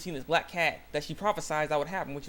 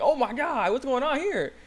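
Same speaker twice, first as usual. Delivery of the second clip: high, loud comic astonishment; mock shock; 10 semitones higher, 5 dB louder, slower than usual. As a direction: act stunned on walking in, pitched way up. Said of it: "seeing [name] there and like".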